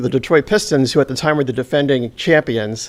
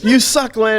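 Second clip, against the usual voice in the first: higher mock heckle; 9 semitones higher than usual. Fans taunting Len after the missed shots, mocking heckle. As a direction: taunt him like a rude fan.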